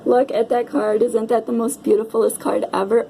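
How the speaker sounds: ordinary delivery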